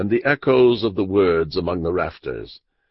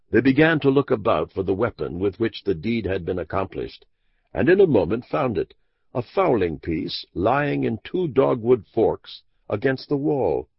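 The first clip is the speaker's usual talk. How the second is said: ordinary delivery, nothing marked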